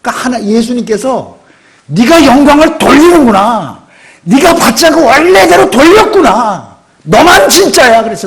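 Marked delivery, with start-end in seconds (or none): 1.9-8.0 high, loud, slow voice of Jesus praising. Acted. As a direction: proclaim it slowly, high and loud.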